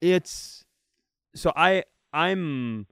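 ordinary talk

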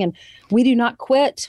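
usual speaking voice

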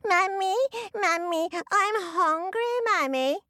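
put-on high, whiny baby crying for mummy. A narrator's reading. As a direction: Finn faking a hungry baby. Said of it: "cry like a baby".

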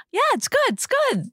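praising the same card yet again; strained praise. Retold or imitated. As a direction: squeak out a tired polite compliment. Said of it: "We're like".

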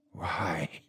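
very soft, low whisper; unsteady whisper. A narrator's reading. Priest questions the frightened man, unsteady whisper. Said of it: "I whispered, my voice unsteady now".

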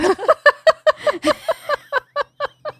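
high squealing laugh; high burst of laughter. Real, not acted. Telling friends the silly test story, laughing high.

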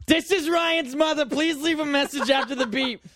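high-pitched mock mom voice; co-host's mother. Host mocks co-host's mother's voicemail greeting, high put-on voice. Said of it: "That's not what she sounds like".

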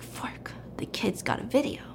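hushed, whispered curse; whispered realization. Retold or imitated. Woman left alone realizing trouble, soft whisper. Simi realizes, muttered to herself.

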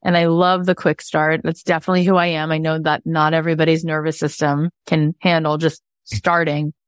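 ordinary talk

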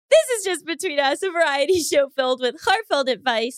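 bright announcer intro; show intro. Acted. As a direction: present the show, upbeat.

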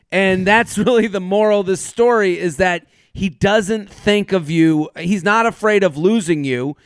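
ordinary talk

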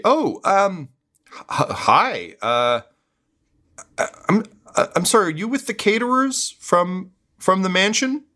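flustered, halting and high; hesitant guard. Acted. Guard unsure whether these are the caterers, hesitant and high. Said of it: "the guards say".

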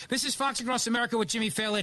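upbeat announcer read; punchy fast radio ID; radio host intro. Acted.

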